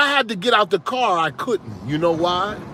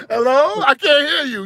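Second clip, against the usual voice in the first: wife on a bad phone line; 7 semitones higher than usual. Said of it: "she'd be like".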